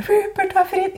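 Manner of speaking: very high, manic phone announcement; mock hysterical. Woman phoning mother and brother about the proposal, manic squeal. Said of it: "manisk og hysterisk i telefonen".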